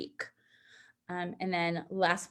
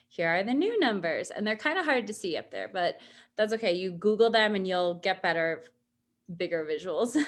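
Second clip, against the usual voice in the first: usual speaking voice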